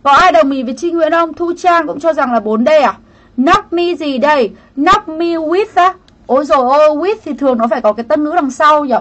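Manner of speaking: usual speaking voice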